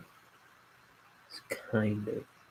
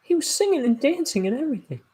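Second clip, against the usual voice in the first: much higher, fast, pleading protest; frantic insistence; faster than usual. Retold or imitated. Man insists to Horace, much higher and frantic. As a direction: insist desperately he really danced. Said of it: "It's like".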